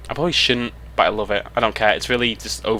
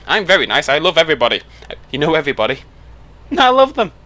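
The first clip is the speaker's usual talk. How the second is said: louder, higher, sugary fake-nice gushing ending in a squeal of laughter; mock sweet niceness; 6 semitones higher, 6 dB louder, faster than usual. Vlogger mocking fake-nice YouTubers, louder, higher, sugary gushing. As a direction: gush over everybody like a fake-nice YouTuber.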